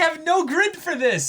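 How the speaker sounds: high, loud baffled exclamation; can't make sense of it; bewildered. Real, not acted. Reacting to the baffling arc, high and loud.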